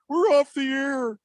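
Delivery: high, slow mock announcement; mocking high-pitched call; imitated station staff. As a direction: mimic them in a high drawn-out voice. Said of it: "So they're like".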